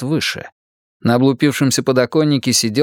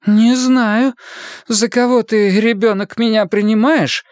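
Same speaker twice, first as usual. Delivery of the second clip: put-on much higher, indignant young woman; lightly voiced affronted girl; offended girl; 11 semitones higher than usual. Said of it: "приняла оскорблённый вид".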